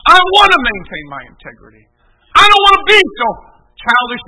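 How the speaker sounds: loud, impassioned vow; fervent declaration. Real, not acted. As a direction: shout out your resolve from the pulpit. Preacher declares his resolve.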